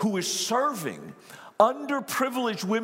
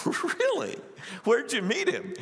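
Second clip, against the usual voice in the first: mock incredulous; 7 semitones higher than usual. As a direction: act out amused disbelief, pitched way up. Acted incredulous reply to Jeff's claim.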